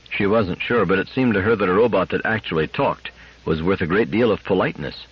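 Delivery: plain reading voice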